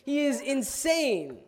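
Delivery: shocked onlooker. Retold or imitated. Listener voiced exclaiming the preacher lost his mind, loud and slow. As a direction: shout it out in disbelief. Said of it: "You would say".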